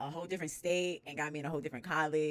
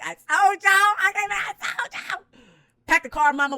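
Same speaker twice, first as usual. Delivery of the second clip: high, loud victory shout; triumphant yell; 15 dB louder than usual. Retold or imitated. Telling family she was right, a triumphant high yell. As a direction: crow it out in triumph. Celebrating acceptance.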